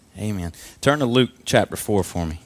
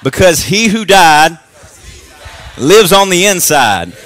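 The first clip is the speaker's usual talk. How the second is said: slow, much higher and louder declaration; shouted confession; 6 semitones higher, 8 dB louder, slower than usual. Shown as a demonstration. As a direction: proclaim it with full conviction. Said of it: "I want you to say it like you mean it".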